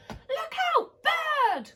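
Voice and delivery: high, loud frantic yell; panicked warning. Acted. Snails spotting the bird, high panicked warning cry. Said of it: "cried the snails in panic".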